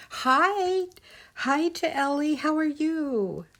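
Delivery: high, loud, drawn-out hello; excited greeting. Real, not acted. Streamer welcomes a viewer to the chat, excited greeting.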